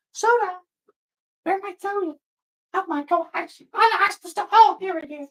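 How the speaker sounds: very high, flustered squeal; high-pitched frantic searching; squeaky panic. Real, not acted.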